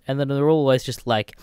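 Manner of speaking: ordinary delivery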